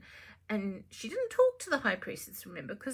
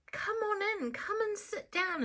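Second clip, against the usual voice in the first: Empress inviting; 10 semitones higher than usual. Acted.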